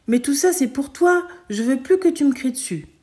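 put-on high, loud childlike plea; pleading child. Son pleads with his mother over the gun. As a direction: beg her to stop shouting.

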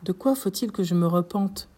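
ordinary delivery

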